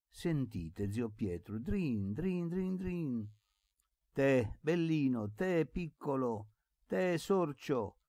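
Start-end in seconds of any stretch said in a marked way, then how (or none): 1.6-3.3 high, ringing mimicked jingle; bell imitation. A narrator's reading. Boy showing zio Pietro the dog's bell, jingling. 4.1-8.0 high, slow coaxing call; calling a dog. A narrator's reading. Boy calling the little dog to him, coaxing.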